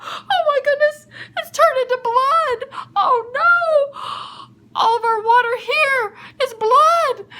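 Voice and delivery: high, wailing cry of alarm; horrified panic. Acted. Egyptian finds his water turned to blood, horrified panic. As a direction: cry out in hammed-up dismay.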